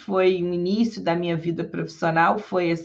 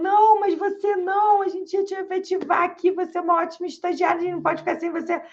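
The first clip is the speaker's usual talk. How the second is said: desperate boss; 10 semitones higher, faster than usual. Retold or imitated. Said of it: "ele ficou bem desesperado".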